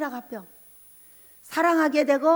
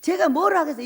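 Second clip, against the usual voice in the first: normal talking voice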